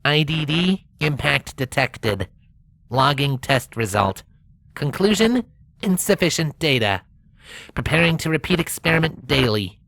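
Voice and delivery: lightly robotic rover. Acted. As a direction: speak like a rover reporting data.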